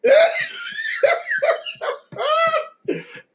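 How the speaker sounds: high shrieking cackle. Real, not acted. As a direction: burst out in a shrill laugh. Big laugh heard.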